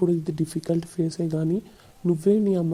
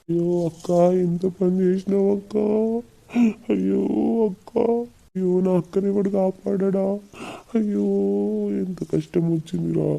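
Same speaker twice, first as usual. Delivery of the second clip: hammed-up wailing; 3 semitones higher than usual. Brother lamenting his dying sister, exaggerated wailing. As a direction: wail in melodramatic grief, stretching the endings. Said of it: "ఏడవకు".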